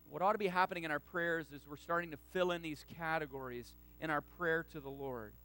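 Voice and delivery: ordinary delivery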